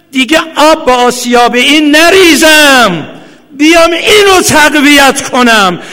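loud, high, sobbing outcry; crying shout. Real, not acted. Preacher weeping before mourners, loud crying shout. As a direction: let the voice break into a wail.

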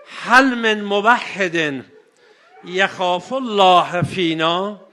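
3 call to the battlefield for a helper; 4 drawn-out appeal. Acted. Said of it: "فریاد زد".

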